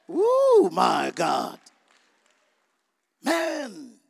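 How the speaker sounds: a long, slow, very loud oh my God; stunned outcry. Real, not acted. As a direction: drag it out as the truth hits. Realising his own pride.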